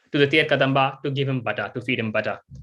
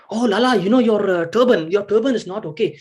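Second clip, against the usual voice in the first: higher, louder doting mother voice; fussing mother; 5 semitones higher, 4 dB louder than usual. Acted. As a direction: fuss over the boy's turban. Mother fussing over turban.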